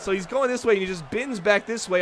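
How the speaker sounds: ordinary delivery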